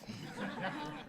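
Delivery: a high airy laugh at his own idea; high breathy laugh. Real, not acted. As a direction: laugh up high, almost silent. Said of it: "laughs at own idea".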